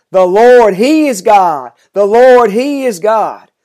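fervent proclamation. A narrator's reading. People fallen on their faces after the fire, proclaiming. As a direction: proclaim it out, hitting LORD hard.

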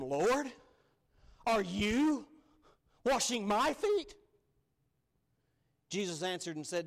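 astonished Peter asking the Lord; shocked disbelief. Acted. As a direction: Peter aghast at the Lord stooping. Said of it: "read it like I envision it".

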